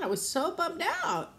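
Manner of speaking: high, plaintive emphasis on "so bummed out"; exaggerated bummed-out whine; emphatic dismay. Real, not acted. Repeats it with emphasis.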